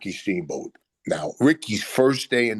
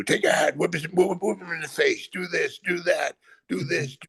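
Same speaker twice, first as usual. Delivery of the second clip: higher, urgent, rapid-fire commands; imitating Bobby's orders; 8 semitones higher than usual. Manager Bobby at ringside telling him what to do, higher urgent orders.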